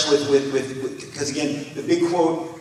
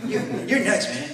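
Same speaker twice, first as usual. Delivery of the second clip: Tim Miller's voice; 4 dB quieter than usual. Retold or imitated. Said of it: "'cause Tim talks like this".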